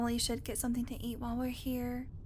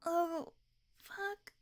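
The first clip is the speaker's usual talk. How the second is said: stretching groan; 6 semitones higher than usual. Acted. Passenger stretching stiff legs after the drive, groaning. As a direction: groan through a long-awaited stretch.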